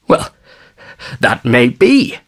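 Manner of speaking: grudging, indignant concession; mildly affronted, reluctant agreement; outraged admission. A narrator's reading. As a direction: admit it with a touch of outrage. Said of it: "admitted the outraged father".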